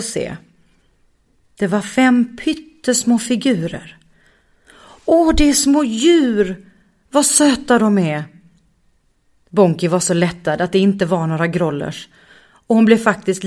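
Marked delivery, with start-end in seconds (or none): 5.0-8.2 delighted. Acted. Bonki ser de små djuren, förtjust och gullande. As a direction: melt at the sight of cute animals.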